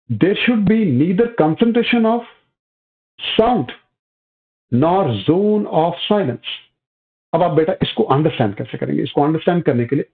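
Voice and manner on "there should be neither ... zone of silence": reads the point out word by word, very slowly; slow dictation. Acted. Teacher to class, dictating a point slowly for notes. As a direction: spaces every word so students can copy.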